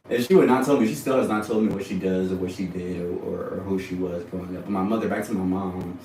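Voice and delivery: usual speaking voice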